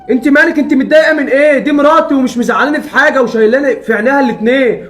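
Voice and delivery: angry. Acted. Son defends wife against mother.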